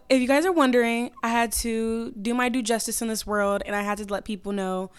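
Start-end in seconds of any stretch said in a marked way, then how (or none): none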